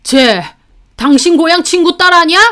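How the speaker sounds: father-in-law confronting his wife; furious demand. A narrator's reading. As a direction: demand an answer in fury. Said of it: "화가 머리끝까지 나셔서 ... 따지셨죠".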